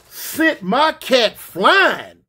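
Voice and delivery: worked-up storyteller indignation; outraged exclaiming. Real, not acted. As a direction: stretch the words in disbelief. Outraged retelling of the kick.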